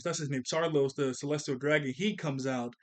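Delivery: normal talking voice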